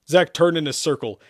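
usual speaking voice

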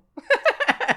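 bright burst of real laughter; a real laugh that bursts out high; loud high laugh. Real, not acted. Laughing at clinic's reply.

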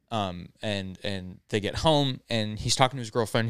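ordinary talk

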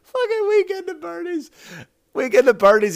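high, laughing outburst; laughing exclaim. Real, not acted. Son cracking up at his mother's joke, laughing high. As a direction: blurt it through laughter.

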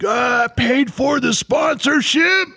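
high, drawn-out trucker yell; mock outraged holler. Impatient trucker pounding his dashboard, mock outraged holler. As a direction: holler it like an irate caricature. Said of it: "Loud One is probably pounding the dashboard".

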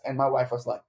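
normal talking voice

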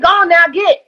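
folksy Southern shooing; Southern drawl. Retold or imitated. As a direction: shoo someone off, country style. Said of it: "I even talk like".

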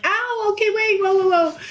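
high, startled ow and whoa; startled pain. Retold or imitated. Reliving the bite from her partner as a high, startled retold yelp.

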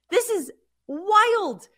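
high, slow, drawn-out amazement; amazed. Real, not acted. Host marvels at the Jets standings, slow amazed disbelief.

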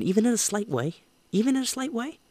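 high-pitched emphasis. Real, not acted. Host on impacting someone, high-pitched and emphatic. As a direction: jump up very high. Host fired up about helping others.

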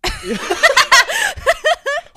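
high shrieking laugh, loud; real helpless laugh; loud burst of laughter. Real, not acted. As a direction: crack up hard at the joke. Laughing at their own bit.

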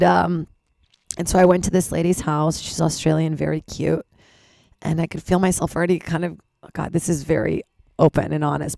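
normal talking voice

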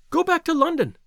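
high, pressing urgent cry. Acted. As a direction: push him away with urgency. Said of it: "she cried".

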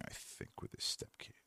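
whispered, half to himself; whispered aside. Real, not acted. Telling viewers where Joe is, whispering under his breath. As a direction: drop into a whisper mid-thought. Trailing aside about absent co-host.

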